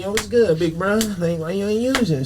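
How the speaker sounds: gang member voice. Acted. Talents confronting him on his deathbed, gang member voice. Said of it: "that's my gang member voice".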